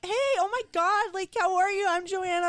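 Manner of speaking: high, loud, gushing greeting; eager small talk. Retold or imitated. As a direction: overdo the chirpy hello to a stranger. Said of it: "trying to, like, you know, make small talk".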